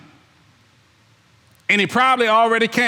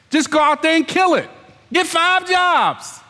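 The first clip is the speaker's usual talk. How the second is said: slow, much higher call to action; fired-up exhortation; 9 semitones higher, slower than usual. Acted. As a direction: push them out the door. Preacher urging the lazy.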